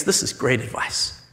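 a hushed, breathy whisper to himself; whispered thought. Acted. Haman whispering his smug plan to himself. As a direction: whisper it like a secret scheme. Haman privately plotting revenge.

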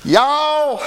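hammed-up Southern drawl, high and stretched; Southern accent. Preacher joking about Peter's accent, exaggerated Southern drawl. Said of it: "We can tell by your southern accent".

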